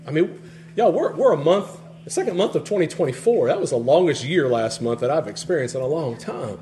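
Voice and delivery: normal talking voice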